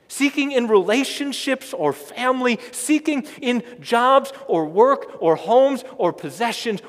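loud, building sermon delivery; full-force preaching. Acted. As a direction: preach it out to the whole congregation.

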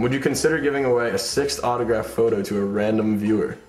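usual speaking voice

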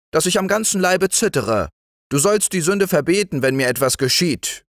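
high, agitated, reproachful wife; defiant wife. A narrator's reading. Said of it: "rief die Frau trotzig". (trotzige, vorwurfsvolle Ehefrau)

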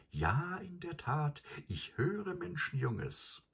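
very high, slow drawling tease; drawn-out teasing. A narrator's reading. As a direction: stretch each word, mocking. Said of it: "ein sonderbares Dehnen in den Worten".

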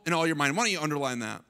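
normal talking voice

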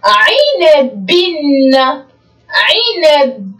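عنب stretched very slowly, each sound held; slow drawn reading. Shown as a demonstration. Teacher to pupils, reading عنب very slowly for them to repeat. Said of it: "أين التنوين بالكسرة؟".

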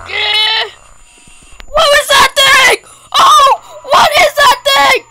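high shrieks of terror; panicked screaming. Real, not acted. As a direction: scream while running away. Fleeing monster, screaming.

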